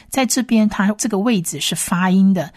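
ordinary delivery

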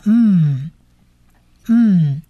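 "嗯" as a long m hum, lips shut; closed-mouth nasal. Shown as a demonstration. Teacher shows the word-final mem, closed-mouth nasal hum. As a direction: hold "嗯" through the nose with mouth closed. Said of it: "然后嘴巴闭起来的".

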